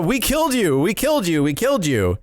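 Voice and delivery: frantic, fading repetition; hammed-up panicked villain.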